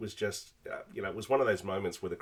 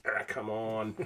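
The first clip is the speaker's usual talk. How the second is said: mock triumphant "come on"; giving it large; 3 semitones higher, slower than usual. Host imitating rival player's celebration, giving it large. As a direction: imitate a player bragging after a big throw. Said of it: "he gave it large".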